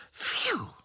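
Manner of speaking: high breathy phew; relieved gasp. Acted. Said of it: "gasped Dotty".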